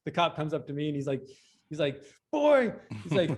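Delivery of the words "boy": put-on a high, loud bark of "Boy"; angry cop. Cop yelling at him.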